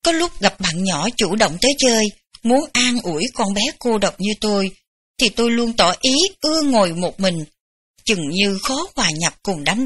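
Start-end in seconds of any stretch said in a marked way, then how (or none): none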